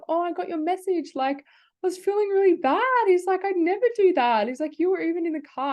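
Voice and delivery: high, earnest imitation of the boyfriend; boyfriend's reassurance. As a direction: voice him protesting his innocence. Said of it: "And he's like".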